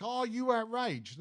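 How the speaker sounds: slow, leading question in a mock presenter voice; mock media bait. Mocking the press asking the public, slow leading mock question. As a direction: bait the caller slowly. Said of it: "what they do is, is like".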